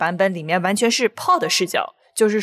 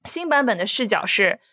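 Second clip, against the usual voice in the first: ordinary talk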